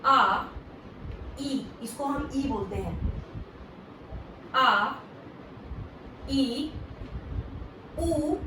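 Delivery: आ, ई, ऊ each said clearly and slowly; Japanese vowels. Shown as a demonstration. Teacher to students: clear, separate Japanese vowels. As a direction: spell out the Japanese vowels one by one. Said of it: "Japanese में होते हैं".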